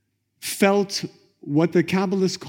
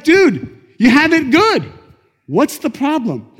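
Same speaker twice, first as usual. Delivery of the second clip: exasperated appeal; 10 semitones higher, 6 dB louder than usual. Acted. The Light to the man who has it good, exasperated. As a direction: reason with him in disbelief.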